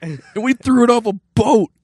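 put-on high squeaky old-timey colonial voice; founding father. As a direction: ham up an outraged founding father. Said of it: "they would have been like".

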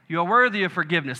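ordinary delivery

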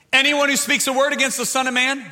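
high, loud scripture proclamation; full-force preaching. Acted. Preacher quoting Jesus to the congregation, loud proclamation. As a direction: declare the verse out to the whole crowd.

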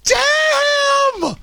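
shouted high, drawn out. Acted. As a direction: belt it out in self-admiration. Exaggerated exclamation.

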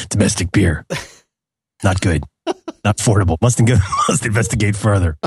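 low terse deadpan read; grim clipped vigilante diary; Rorschach voice. Retold or imitated. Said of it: "you read it as Rorschach".

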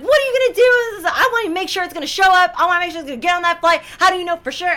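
high, fast, hammed-up demands; retold screaming. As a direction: fire off frantic questions at the clerk, pitch up high. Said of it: "I'm, like, screaming at this lady".

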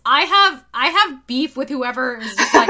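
high, loud indignant rant; outraged rant. Real, not acted. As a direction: rant it, fired up. Ranting about gatekeepers.

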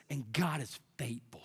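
low, soft scripture reading; hushed reverence. Real, not acted. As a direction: read it softly, as quiet assurance. Hushed first reading of verse.

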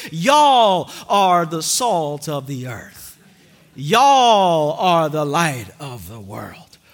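slow, low Southern drawl on Y'all; Southern accent. Shown as a demonstration. Preacher imitating a Southern Jesus, Southern accent. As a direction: lean into a Southern y'all, slowly. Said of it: "if Jesus was from the South".

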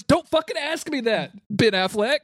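high-pitched, hammed-up indignation; mock exasperated outburst. Actor fed up with an interviewer's apple question, mock exasperated. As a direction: play a fed-up, high-voiced refusal.

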